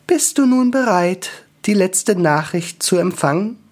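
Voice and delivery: high, solemn angel question; high serene angel. Acted.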